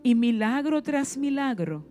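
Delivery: ordinary talk, nothing marked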